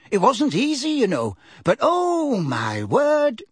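put-on fussy old cat lady, tragically sorry; tragic old neighbour. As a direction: wring your hands over it. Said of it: "she said tragically".

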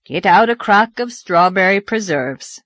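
plain reading voice

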